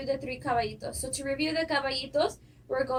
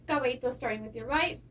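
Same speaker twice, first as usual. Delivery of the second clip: ordinary delivery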